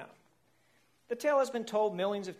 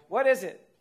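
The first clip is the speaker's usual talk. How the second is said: louder, urgent worried ask; anxious question; 4 semitones higher, 9 dB louder than usual. A narrator's reading. Whale begging the crab doctor, loud and anxious. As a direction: press the doctor for an answer. Worried patient asks again.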